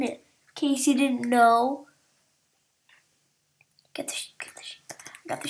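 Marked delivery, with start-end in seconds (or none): 3.9-5.5 urgent whisper. Real, not acted. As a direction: hiss the order under breath. Whispering mid-game.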